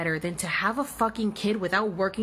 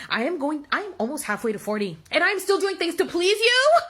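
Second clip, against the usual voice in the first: angry rant; 5 semitones higher than usual. Real, not acted. Furious rant at parents.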